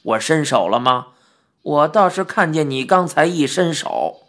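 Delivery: put-on old man getting defensive; lightly voiced old man, mildly flustered. A narrator's reading. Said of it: "怪老头儿急了".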